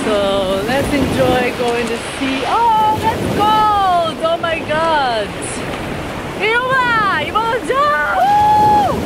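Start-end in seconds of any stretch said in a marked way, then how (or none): 2.5-9.0 high squealing shock at the cold; shrieking at cold water. Real, not acted. Running into cold sea.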